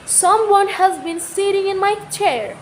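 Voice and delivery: a hint of a big father bear; Papa Bear's big voice. A narrator's reading. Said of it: "Papa Bear said in his big voice".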